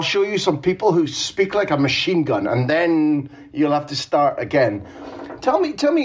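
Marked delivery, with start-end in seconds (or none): 5.4-6.0 higher, eager tell me, tell me; eager prodding. Real, not acted. Host pressing his guest to answer, eager and higher. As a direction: coax the answer out of him keenly.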